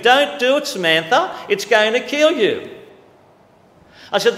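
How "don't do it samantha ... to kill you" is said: high, loud cry of "Don't do it"; urgent warning yell. Retold or imitated. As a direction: shout to stop her drinking poison. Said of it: "I'd at least be yelling and screaming".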